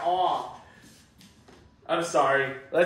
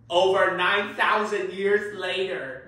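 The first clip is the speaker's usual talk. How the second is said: slow, grand announcer read; slow documentary narrator; narrator title card; 3 dB louder than usual. Shown as a demonstration. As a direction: read it like a cartoon time card. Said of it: "Let's try that again".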